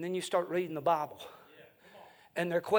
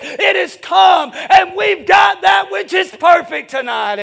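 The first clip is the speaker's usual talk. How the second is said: loud, raised, hard-driven sermon delivery; full-force preaching; 10 semitones higher, 15 dB louder than usual. Acted. Preacher rallying the congregation behind the Bible, full-force preaching. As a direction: preach it at a shout, building to a drawn-out finish.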